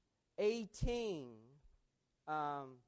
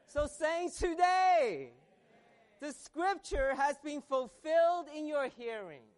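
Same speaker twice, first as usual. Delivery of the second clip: high, loud proclamation to the congregation; exultant declaration from the pulpit; full-force preaching; 6 semitones higher, 7 dB louder than usual. Real, not acted. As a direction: preach it out with raised voice.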